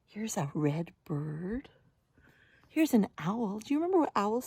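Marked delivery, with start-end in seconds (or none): none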